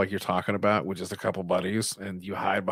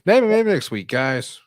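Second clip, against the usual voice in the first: higher, louder call-out; emphatic repeat; 8 semitones higher, 7 dB louder, slower than usual. Real, not acted. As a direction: call it out playfully to the guys.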